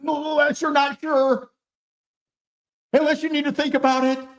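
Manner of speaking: high, unsure backpedal; mock timid salesman caving in; high squeaky hedging; wishy-washy add-on. Don't do it.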